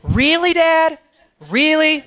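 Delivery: lightly voiced eye-rolling teen; a hint of a fed-up daughter; exasperated daughter. Pastor imitating his daughter's eye-roll to the congregation, mild mock. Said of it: "not my daughter's favorite word".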